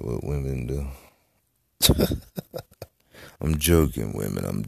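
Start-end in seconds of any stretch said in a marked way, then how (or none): 1.8-2.8 short breathy chuckle at own joke; teasing laugh. Real, not acted. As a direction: laugh off your own jab.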